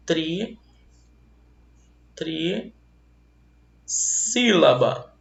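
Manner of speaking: "trissílaba" split after "Tri-", long pause, drawn out; separação pausada. Shown as a demonstration. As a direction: stretch "trissílaba" slowly, pausing after "Tri-". Professor dita o termo em partes. ("trissílaba" dita devagar, com pausa depois de "Tri-")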